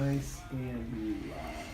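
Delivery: slow, soft reassuring murmur; soothing massage murmur. Real, not acted. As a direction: ease them into relaxing. Chiropractor soothing patient on table.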